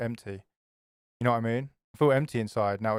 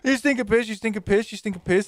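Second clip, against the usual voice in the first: taunting yell; 6 semitones higher than usual. Pretend. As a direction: jeer at the ghost. Taunting the ghost louder.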